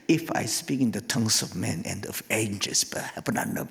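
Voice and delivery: put-on a parent drilling a child in slow English; mock English recitation. Preacher mimics mother drilling her child in English, mock slow careful voice.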